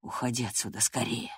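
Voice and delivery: low, hushed urging; serious whisper. A narrator's reading. Gerton urges Catherine to leave, whispering seriously. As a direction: whisper it earnestly, very softly. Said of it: "серьёзно прошептал он".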